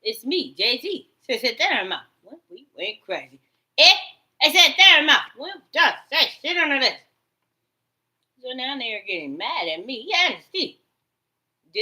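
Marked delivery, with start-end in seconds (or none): 3.8-7.0 mock taunting shout. Mimicking the voices' taunts.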